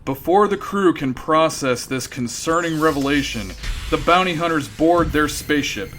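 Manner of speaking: normal talking voice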